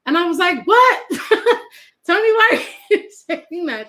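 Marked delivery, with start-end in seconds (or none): 0.7-1.0 high, loud startled what; incredulous, loud what; surprised what. Retold or imitated. Shocked reaction in retold story. 1.1-1.6 unvoiced burst of laughter; a quick airless laugh; short breathy chuckle. Real, not acted. Laughs at retold moment. 2.1-3.9 laughing through a puzzled question; amused, louder why. Retold or imitated. Retold puzzled question to aunt.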